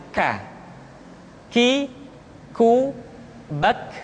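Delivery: "K", "Ki", "ku", "bak" with air released after k; breath-flowing k. Shown as a demonstration. Teacher demonstrating kaf, aspirated "bak". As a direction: say "K. Ki, ku, bak" with a puff of breath on every k. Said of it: "nafas yang mengalir".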